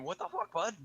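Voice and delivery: high-pitched mock scream; shrieky weird-accent outburst. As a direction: imitate yourself yelling in outrage. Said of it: "It's literally just me screaming".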